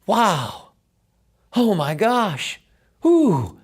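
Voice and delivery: amazed. Retold or imitated. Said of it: "I stood on the side of the pool and went".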